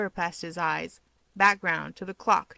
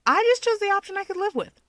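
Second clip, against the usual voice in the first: much higher, louder chirpy retort; breezy Keigo; 12 semitones higher, 6 dB louder, faster than usual. Acted. Keigo answering Toya, chirpy and higher. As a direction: toss it back brightly.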